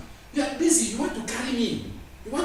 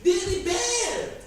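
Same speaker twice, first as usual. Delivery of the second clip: higher, louder, slow preaching cry; fervent proclamation; 11 semitones higher, 3 dB louder, slower than usual. Acted. Preacher crying out Israel's rebellion, raised and slow. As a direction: cry it out over the congregation.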